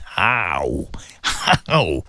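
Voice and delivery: a single word caught by real tears; choking up. Real, not acted. Radio host asking about losing a parent, voice breaking in tears. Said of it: "I'm holding my tears".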